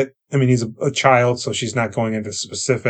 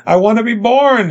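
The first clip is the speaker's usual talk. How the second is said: much higher and louder, pleading; urgent plea; 10 semitones higher, 5 dB louder than usual. Retold or imitated. Boy begging his grandfather, high loud plea. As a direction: beg it with rising urgency. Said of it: "That's good".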